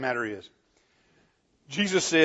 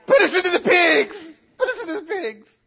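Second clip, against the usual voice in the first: very high, loud, laughing plea; frantic demons; 7 dB louder than usual. Acted. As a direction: shriek the request with a manic laugh. Demons begging for pigs.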